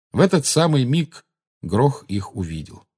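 ordinary delivery, nothing marked

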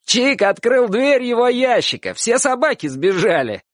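high, excited shouted report; calling out. A narrator's reading. Neighbour to aunt upstairs with news, calling out. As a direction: call the news up to someone. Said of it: "один из них крикнул тётушке".

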